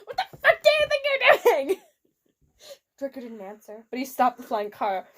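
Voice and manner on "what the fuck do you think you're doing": angry yell. A narrator's reading. Angry shout at Draco.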